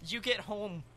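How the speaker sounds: soft Scottish-tinged narration; quiet, accidental Scottish lilt; Scottish accent. Real, not acted. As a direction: read it with a slip into Scottish. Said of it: "I started that in a Scottish accent".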